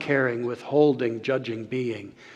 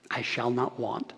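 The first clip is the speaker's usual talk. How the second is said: quiet proclamation; 4 semitones lower, 9 dB quieter than usual. Retold or imitated. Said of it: "he would proclaim".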